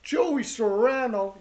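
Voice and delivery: slow, loud mobster-style name drop; New York Italian accent. Pretend. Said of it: "you gotta say that with an Italian".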